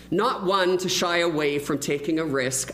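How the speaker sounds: ordinary talk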